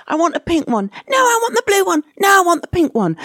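put-on high, insistent kid voice, climbing on the blue one; fickle child demanding. A small child flip-flopping over a pink or blue toy, high demanding whine. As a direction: whine like a toddler who keeps changing their mind. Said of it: "when they say".

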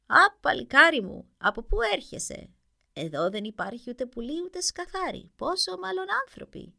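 put-on old witch voice. A narrator's reading. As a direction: croak it out like a fairy-tale witch. (τσιριχτή φωνή γριάς μάγισσας)

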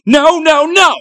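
high, loud, repeated cries of denial; frantic high-pitched protest; horrified shouting. Acted. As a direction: yell it in anguished refusal. Boy horrified at being fooled.

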